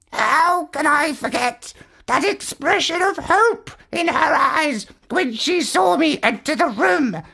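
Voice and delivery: high, earnest parrot voice, near tears; a smitten bird pleading to save his love, pitched high; high parrot voice, slowing at the vow; lovesick parrot. A narrator's reading.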